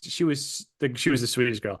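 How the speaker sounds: normal talking voice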